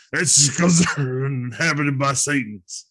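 slow, put-on conspiracy-host voice; Alex Jones impression. Co-host as Alex Jones explaining away his kids, slow impression. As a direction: drag out the paranoid claim. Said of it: "That's a pretty good Alex Jones".

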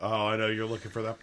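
usual speaking voice

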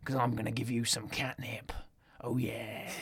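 whispered, low threat; soft whispered tough-guy cat; menacing whisper. Retold or imitated. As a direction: breathe the threat like a hard man. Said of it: "Vinnie Jones' cat is like".

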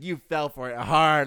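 loud, slow, emphatic crowing; mock gloating. Boss proud of fooling his clerk, loud gloating. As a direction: rub it in loudly, word by word.

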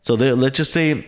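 usual speaking voice

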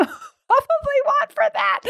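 high-pitched, breaking up into laughter; helpless laugh bubbling through the words. Real, not acted. Breaks up laughing at story's punchline.

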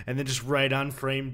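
usual speaking voice

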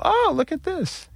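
put-on very high, slow tiny child voice; a dad voicing his toddler watching Elmo. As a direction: chirp it like a toddler.

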